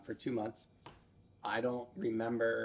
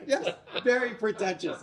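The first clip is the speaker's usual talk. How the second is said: laughing; 8 semitones higher, 4 dB louder than usual. Real, not acted. Laughing agreement.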